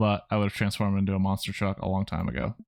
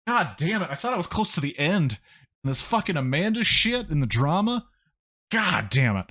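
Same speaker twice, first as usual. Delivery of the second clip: high, exasperated outburst; real exasperation; 7 semitones higher than usual. Real, not acted. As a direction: whine in frustration at the drama dragging on. Breaks off narration, frustrated at the drama.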